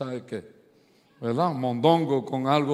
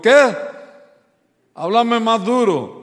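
higher, louder shouted what, then slowly; hammed-up deaf husband shouting; 7 semitones higher, 9 dB louder, slower than usual. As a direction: yell back as if half deaf. Hard-of-hearing husband calling out.

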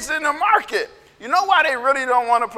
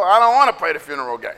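low, hurried grumble; quick, dropped-pitch refusal; sulky kid refusal; 7 semitones lower, faster than usual. Acted. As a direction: shoot the idea down. Kids refusing the second game.

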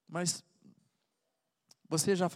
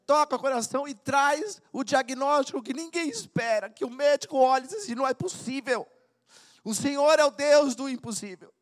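high and loud fervent sermon; impassioned preacher crying out; preaching at full force; 8 semitones higher, 7 dB louder than usual. Acted. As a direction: preach it with the heart torn open.

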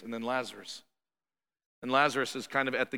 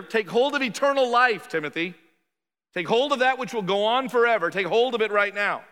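raised, high, forceful urging; urgent exhortation; 6 semitones higher, 4 dB louder than usual. Acted. Paul urging Timothy to take hold, raised urgent exhortation. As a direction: press the command home with a high, loud push.

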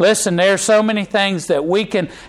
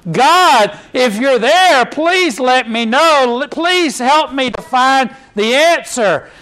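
higher, slow pleading cry to God; pleading prayer; 7 semitones higher, 3 dB louder, slower than usual. Retold or imitated. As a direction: beg God for an answer, slowly. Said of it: "they're crying out to God".

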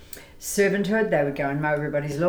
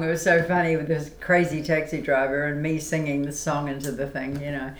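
ordinary talk, nothing marked

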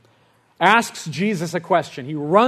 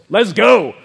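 loud, punchy "here we go"; eager battle cry; 4 semitones higher, 10 dB louder than usual. Retold or imitated. Young preacher jumping in to pray, eager shout. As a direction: fire it out, louder and higher. Hyped self entering the prayer.

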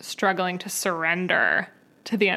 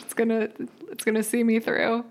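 usual speaking voice

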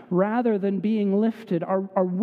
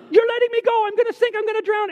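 much higher and louder, panicking; frantic mock cry of a child sinking; panicked child; 11 semitones higher, 4 dB louder, faster than usual. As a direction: imitate a terrified kid sure he'll drown. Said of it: "the kid loses it and says".

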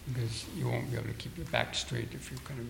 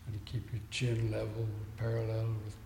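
guided meditation; 4 dB quieter than usual. Real, not acted. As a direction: talk them into stillness, unhurried and steady. Leads seated breathing meditation.

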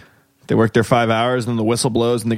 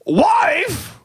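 very high, slow sobbing whine; wailing old simpleton delivering the punchline; hammed-up crying wail; much slower than usual. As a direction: break into a mock sob for the punchline. Punchline whining cry.

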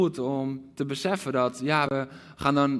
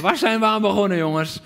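higher, louder call to the men; cry out; 5 semitones higher, 6 dB louder than usual. Acted. Preacher voicing commander calling out to soldiers, loud. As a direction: call out the doubt to the troops. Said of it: "als die begint uit te roepen".

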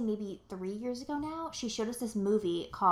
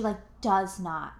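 normal talking voice

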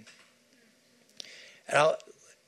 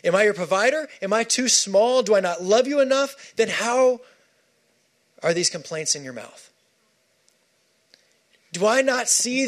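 a hint of God speaking; God's voice; 6 semitones higher than usual. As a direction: plead as a father hurt by doubt. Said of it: "God is standing by going".

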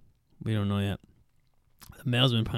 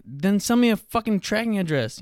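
higher, demanding retort; exasperated demand; 9 semitones higher, 3 dB louder than usual. Retold or imitated. Demanding tracking from the online seller, exasperated. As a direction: snap back at them for the tracking. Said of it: "I'm like".

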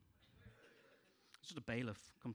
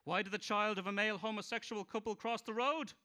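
loud, higher punchline voice; stand-up joke telling; 5 semitones higher, 4 dB louder than usual. Acted. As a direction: sell each gag to the crowd. Comic telling jokes.

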